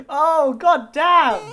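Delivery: loud, high, drawn-out astonishment; amazed outburst. Real, not acted. Driver watches the toss off the roof, loudly amazed. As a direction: stretch it out slowly and loudly, stunned.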